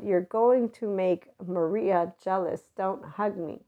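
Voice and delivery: ordinary talk, nothing marked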